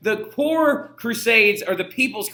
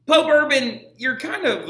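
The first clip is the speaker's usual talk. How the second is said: mock scolding address; 7 semitones higher, 4 dB louder than usual. Teacher scolds the pope directly.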